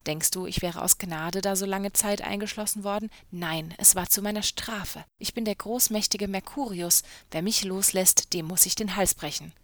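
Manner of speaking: ordinary delivery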